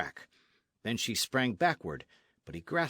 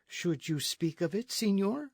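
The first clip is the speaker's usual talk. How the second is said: put-on questioning, raised voice of a young lady; reserved señorita; 8 semitones higher than usual. Señorita questions her unwelcome suitor, reserved and higher. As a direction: ask it higher, lifting on the last word.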